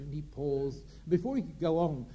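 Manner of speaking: ordinary talk, nothing marked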